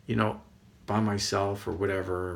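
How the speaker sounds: ordinary talk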